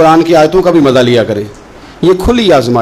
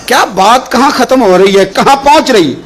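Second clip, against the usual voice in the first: rapturous preaching; 9 semitones higher than usual. Acted. Preacher marvels at the verse before the congregation, rapturous preaching. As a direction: marvel high, then draw the verse out.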